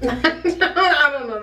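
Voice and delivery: slow, laughing through words. Real, not acted. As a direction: giggle it out. Laughing at food.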